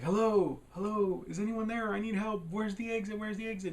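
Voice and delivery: high, panicked calling out, acted; freaking out. Lost kid calling into the empty library, mock panic. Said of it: "and he's freaking out".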